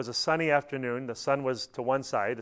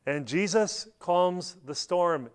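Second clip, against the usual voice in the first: ordinary talk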